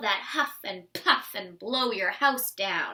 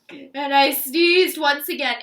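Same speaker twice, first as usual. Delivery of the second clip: sneezing out loud; 2 semitones higher, 10 dB louder than usual. A narrator's reading. Sneezing loudly.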